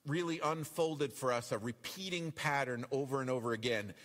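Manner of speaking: ordinary delivery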